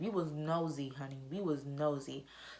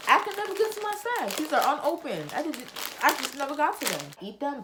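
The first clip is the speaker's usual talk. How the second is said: much higher, louder excited squeal over snacks; 7 semitones higher, 3 dB louder than usual. Real, not acted. Vlogger spotting her snacks, excited squeal. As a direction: gush over the unopened snacks.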